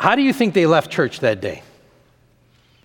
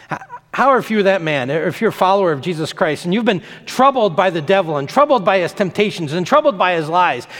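normal talking voice

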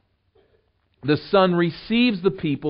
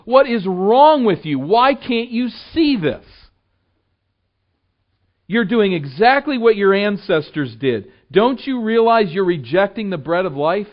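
louder, higher, scolding exasperation; mock exasperated rant; 5 semitones higher, 6 dB louder than usual. Preacher shows what Jesus did not say, exasperated and loud. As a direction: pretend to berate them, then drop it. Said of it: "He does not say".

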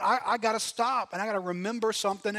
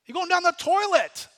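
high, loud scolding inner voice; jeering at himself, pitched up and loud; mocking inner critic; 11 semitones higher, 10 dB louder than usual. Preacher voicing his own inner critic, mock scolding. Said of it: "And you're like".

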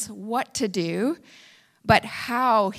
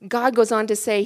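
ordinary delivery

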